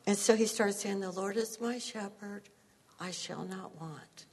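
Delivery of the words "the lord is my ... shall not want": soft, sleepy murmured psalm; quiet recital. Retold or imitated. Husband reciting the psalm in bed, softly murmured. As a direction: murmur it to fall asleep.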